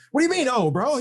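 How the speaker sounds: you can't believe he's so calm about it; incredulous disbelief. Retold or imitated. Texting a friend close to a thousand subs, incredulous.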